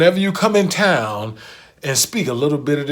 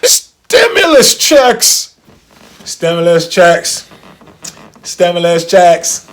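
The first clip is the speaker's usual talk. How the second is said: loud, drawn-out announcer hype; hyped shout; 9 dB louder, slower than usual. Acted. Host shouting the topic to his listeners, hyped. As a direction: call it out like a big reveal. Hyping the money segment.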